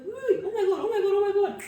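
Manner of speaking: the truth crashing in all at once; panicked realization. Retold or imitated. Waking at midnight realizing her dad died, panicked cries.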